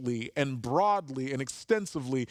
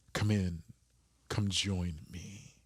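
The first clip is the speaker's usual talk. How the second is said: soft, slow and much quieter, a gentle invitation; tender plea; 9 semitones lower, 5 dB quieter, slower than usual. Retold or imitated. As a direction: coax him inside gently, almost under the breath. Father pleads with his son.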